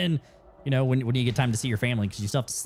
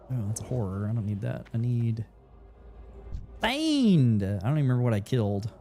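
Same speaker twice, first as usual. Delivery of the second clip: normal talking voice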